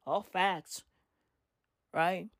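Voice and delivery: ordinary delivery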